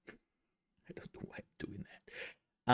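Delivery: hushed self-questioning whisper; whispered aside. Real, not acted. Streamer to self after a silly greeting, whispered aside.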